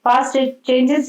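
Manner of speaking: normal talking voice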